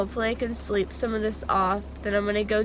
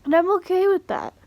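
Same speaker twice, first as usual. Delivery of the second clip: mock cheerful; 9 semitones higher, 7 dB louder, faster than usual.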